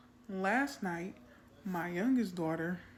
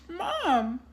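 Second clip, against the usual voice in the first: much higher, louder sobbing Mom; crying child; 10 semitones higher, 7 dB louder than usual. Retold or imitated. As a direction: wail Mom like a crying little girl. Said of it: "she busted out crying".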